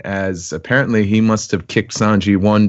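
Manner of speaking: ordinary delivery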